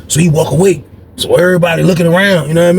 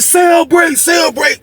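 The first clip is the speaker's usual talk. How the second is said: calling out; 12 semitones higher, slower than usual. Retold or imitated. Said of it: "they said".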